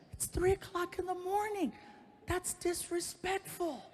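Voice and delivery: put-on high, slow, prim scolding girlfriend. Girlfriend scolding him at three a.m., high and prim. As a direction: play her as the prissy voice of reason.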